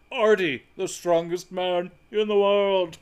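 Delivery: strongman announcer voice. Retold or imitated. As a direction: present him like a circus strongman. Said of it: "You did the voice and everything".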